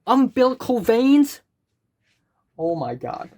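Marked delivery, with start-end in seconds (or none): 0.1-1.4 exasperated outburst. Real, not acted. Outraged at a study answer.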